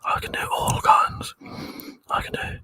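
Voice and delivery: hushed ASMR whisper for the stream chat. Pretend. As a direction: whisper like you're lulling viewers to sleep. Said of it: "Would you like some A S M R?".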